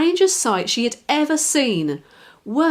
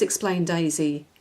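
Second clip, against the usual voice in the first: ordinary delivery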